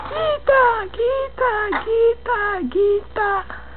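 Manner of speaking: high, fading playful coaxing. Real, not acted. Mother coaxing baby to shout.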